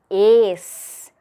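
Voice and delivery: "es" alone, loud and drawn out; teacher's loud, held "es"; isolated syllable. Shown as a demonstration. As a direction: sound out "es" as one separate syllable. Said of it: "A primeira sílaba é".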